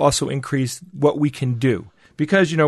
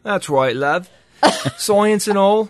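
put-on British accent; 5 semitones higher, slower than usual. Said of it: "Can we hear a little taste of that".

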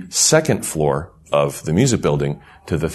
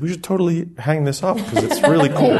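laughing; 9 semitones higher than usual. Retold or imitated. Retelling own suggestion, laughing.

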